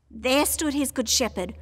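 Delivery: plain reading voice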